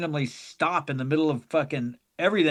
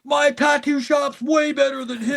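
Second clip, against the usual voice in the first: higher, cocky dude voice, lightly done; rival fan boast; 8 semitones higher than usual. Retold or imitated. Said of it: "another dude goes".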